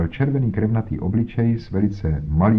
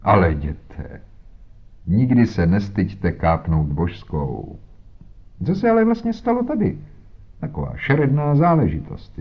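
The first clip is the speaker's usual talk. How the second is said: pompous inspector. Acted. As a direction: lecture Holmes with condescension. Voiced inspector Jones talking. (nafoukaný inspektor, výš posazený hlas)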